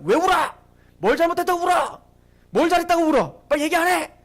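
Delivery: high, loud mock scolding; rapid-fire angry demands, acted out. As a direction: imitate a boss snapping at crying kids. Acted scolding of crying children.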